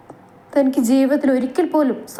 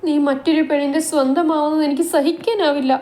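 tearful plea; 6 semitones higher than usual. Acted. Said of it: "അവൾ കണ്ണീരോടെ".

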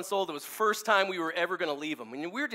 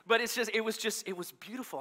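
usual speaking voice

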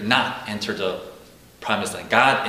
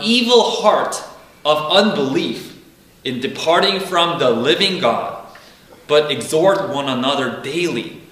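scripture reading; 4 semitones higher than usual. Acted. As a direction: read the warning with weight. Reading verse twelve.

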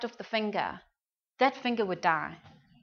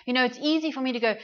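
ordinary delivery

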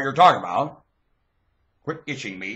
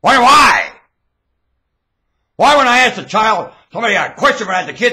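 loud, high tirade; worked-up, high and loud; shouted rant; 11 semitones higher, 12 dB louder, faster than usual. Real, not acted. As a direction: raise the voice and demand an answer.

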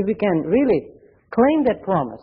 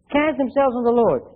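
ordinary talk, nothing marked

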